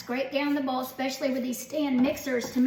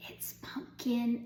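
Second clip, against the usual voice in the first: slow teasing whisper; 5 dB quieter, slower than usual. Acted. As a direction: whisper the secret, slowly. Teasing secret ingredient reveal.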